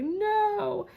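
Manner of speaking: high, drawn-out whiny protest; complaining mock wail; whining. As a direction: whine it with the pitch climbing.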